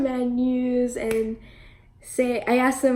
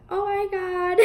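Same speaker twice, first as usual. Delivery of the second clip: starstruck gasp; 8 semitones higher than usual. Retold or imitated. Reacting to coworker's story.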